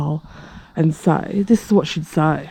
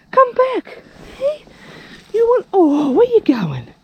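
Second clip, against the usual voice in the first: calling after cat. Real, not acted. Woman calling after a departing cat, high pleading coo. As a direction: plead with the kitty to come back.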